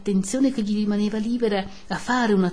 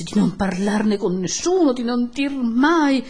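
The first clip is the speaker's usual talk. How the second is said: urgent pleading; 5 semitones higher, 5 dB louder than usual. Acted. Don Abbondio begging for secrecy, urgent pleading. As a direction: beg anxiously, stretching the last words.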